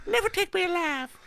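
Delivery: high whooping cowboy yell. Pretend. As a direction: holler like a wild cowboy. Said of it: "get your best cowboy voice ready".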